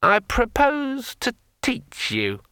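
high, slow, chatty sword voice; a cheerful magic sword rambling on; talking sword. A narrator's reading. Sword Kring speaks.

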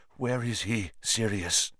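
quiet, shaky with suppressed feeling; shaking voice. Acted. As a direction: voice trembling with held-back emotion. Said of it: "in an odd voice, a voice that shook".

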